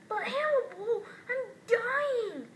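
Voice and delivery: high, loud, hammed-up cry of despair; mock dying wail. Toy begs Hannibal with a hammed-up dying wail. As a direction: wail it like a toy in mortal danger. Dramatic plea to leave room.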